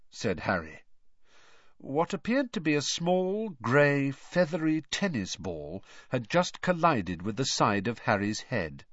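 plain reading voice

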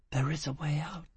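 soft, unvoiced whisper; sweet murmur in the ear. A narrator's reading. Angel whispering into Foyle's ear, sweet and comforting. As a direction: breathe it into his ear, comforting. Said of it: "his angel murmured in his ear, sweetly".

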